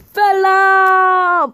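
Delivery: high, loud, drawn-out wrestler bellow; Sheamus. Pretend. Wrestler figure makes his entrance, long high mock shout. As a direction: belt out the catchphrase and hold it. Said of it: "here comes Sheamus, and he's all like".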